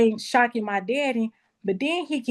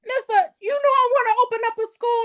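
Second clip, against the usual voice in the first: eager; faster than usual. Retold or imitated. Retelling her eager pitch.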